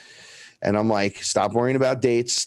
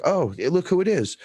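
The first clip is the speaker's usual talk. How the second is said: higher-pitched mock surprise; higher, retold "look who's here" reaction; acted surprise; 8 semitones higher than usual. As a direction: greet them with put-on surprise. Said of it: "and I was shocked".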